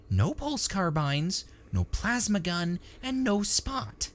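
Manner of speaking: high, drawn-out complaint; whiny techie. A narrator's reading. Techie explaining the banned gear, high whine. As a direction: spell out each loss in a high voice. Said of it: "replied the techie".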